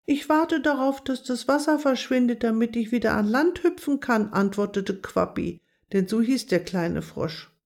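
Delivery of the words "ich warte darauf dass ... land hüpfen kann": higher, small frog voice; lightly voiced little frog, stuck and hopeful. A narrator's reading. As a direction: pipe up like a tiny frog stuck on a rock. Frog Quappi answers Agathe.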